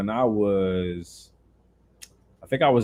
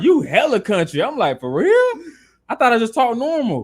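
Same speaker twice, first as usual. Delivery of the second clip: laughing disbelief; 12 semitones higher than usual. Retold or imitated. Retelling surprise, laughing, high pitch.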